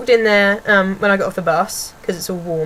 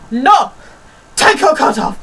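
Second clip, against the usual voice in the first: loud, higher mock scolding; imitated order; 4 semitones higher, 5 dB louder than usual. Imitating lady interrupting pupil undoing buttons, scolding shout. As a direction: cut in with a bossy yell. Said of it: "She goes".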